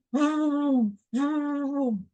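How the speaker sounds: slow drawn-out vroom vroom; drawn-out engine vroom; motorbike vroom. Shown as a demonstration. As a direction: imitate a revving motorcycle. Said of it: "a noisy motorcycle".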